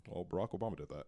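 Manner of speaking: normal talking voice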